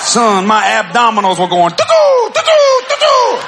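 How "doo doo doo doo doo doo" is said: very high, loud, laughing doo-doo; mock falsetto singsong. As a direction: squeal it in a silly high voice. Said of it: "you ain't got to talk like it".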